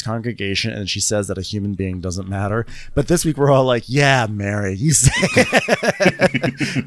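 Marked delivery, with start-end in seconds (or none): 3.9-6.9 drawn-out laughing you say it; laughing cheer. Retold or imitated. Host cheers Mary on while laughing, slow. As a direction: cheer her on through laughter, slowly.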